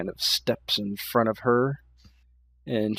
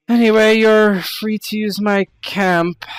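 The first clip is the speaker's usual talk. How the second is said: commanding priestess; 8 semitones higher, 9 dB louder than usual. Acted. GM voices Fatima granting camp.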